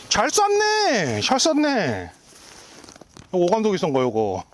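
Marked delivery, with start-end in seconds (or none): 0.1-2.1 slow, high, stretched-out praise; drawn-out cheer. Real, not acted. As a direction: sing out the praise, dragged long. Excited archery vlog cheer.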